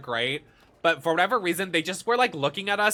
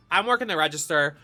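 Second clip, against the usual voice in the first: ordinary talk, nothing marked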